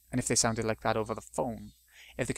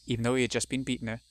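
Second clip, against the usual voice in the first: plain reading voice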